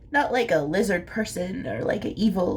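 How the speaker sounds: normal talking voice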